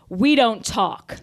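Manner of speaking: each word spaced out slowly; slow it right down and shut her out; slow, final. Acted. To her rival, a slow dismissive sign-off. Acted quarrel.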